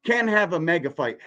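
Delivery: ordinary talk, nothing marked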